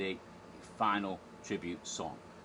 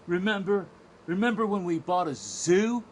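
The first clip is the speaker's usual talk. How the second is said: loud, high, pepped-up character voice; bright-eyed mock enthusiasm, pitched up; imitated cheering-up; 8 semitones higher, 6 dB louder than usual. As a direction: act out rallying a downcast friend. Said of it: "Bill is trying to cheer him up".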